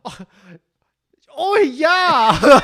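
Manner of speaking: high, shouted mock-Aussie yes; fake Australian accent. Guy claiming to be Australian, shouted fake accent. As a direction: bellow a hammed-up Australian reply. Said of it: "you're Australian?".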